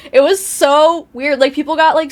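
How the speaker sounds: ordinary delivery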